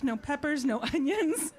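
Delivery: ordinary talk, nothing marked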